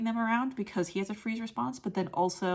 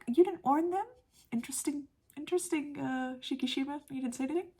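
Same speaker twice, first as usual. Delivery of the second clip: high-pitched, teasing disbelief; playfully scandalized friend; mock incredulous; 7 semitones higher than usual. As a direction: question him in pointed, raised-pitch surprise. Acting friends' surprised, nosy reaction.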